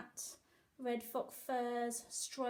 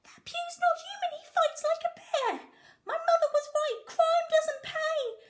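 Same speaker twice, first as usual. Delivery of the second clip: whining robber Billy; 9 dB louder than usual. A narrator's reading. Beaten robber Billy whines.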